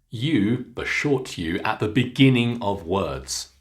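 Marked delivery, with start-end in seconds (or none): none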